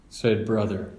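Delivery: usual speaking voice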